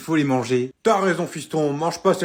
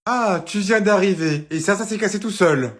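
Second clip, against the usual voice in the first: higher, louder mocking repeat; mock his excuse, angry; sarcastic anger; 6 semitones higher, 4 dB louder than usual. Mum mocks her son's excuse, sarcastic and angry.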